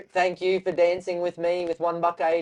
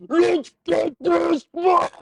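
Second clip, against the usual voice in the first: high, drawn-out full-force yell; big man yelling; 7 dB louder, much slower than usual. Retold or imitated. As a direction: imitate a big man roaring in your ear, slowly stretched. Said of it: "I hear this big man yelling".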